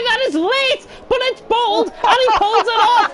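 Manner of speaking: high, loud race call at the climax; exhilarated motorsport commentator at full tilt; thrilled play-by-play. Real, not acted. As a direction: shout the daring move home. Shouted call of late overtake.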